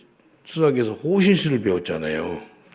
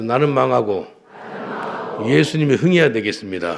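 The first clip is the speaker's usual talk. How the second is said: call to repeat; 2 semitones higher, 5 dB louder than usual. Real, not acted. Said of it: "한번 따라합시다".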